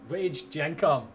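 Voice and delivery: usual speaking voice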